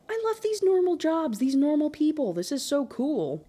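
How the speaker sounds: high-pitched, delighted squeal of approval; excited gushing. Real, not acted. Gushing over characters' normal jobs.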